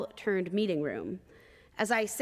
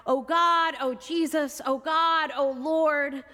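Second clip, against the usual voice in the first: wailing appeals, higher and louder; crying out; 6 semitones higher, 4 dB louder, slower than usual. Retold or imitated. Grieving mother in prison cell, wailing out to God. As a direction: cry out to God in anguish. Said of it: "crying out".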